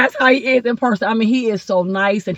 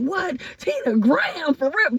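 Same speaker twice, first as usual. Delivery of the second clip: high, softer disbelieving squeal; retold amazement; 7 semitones higher, 4 dB quieter, slower than usual. Reliving meeting Tina Graham, amazed high voice. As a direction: replay your starstruck astonishment. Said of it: "and I was like".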